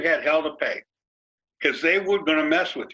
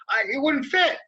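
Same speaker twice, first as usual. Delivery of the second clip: much higher, pleading it's not his fault; defensive excuse; 9 semitones higher than usual. Retold or imitated. Driver explaining his arm out the window, mock defensive pleading. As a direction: whine out the excuse. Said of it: "I said".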